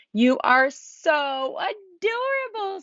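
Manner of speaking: high, slow, delighted squeal. A narrator's reading. Lucy coos at the baby penguins, squealing high and slow. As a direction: coo over something impossibly cute. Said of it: "squealed Lucy".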